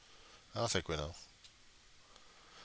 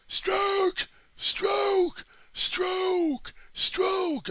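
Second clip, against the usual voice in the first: much higher shouted rowing calls; mock galley command; 5 dB louder, much slower than usual. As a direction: bark the rowing beat like a slave driver. Mock rowing drum-master calls.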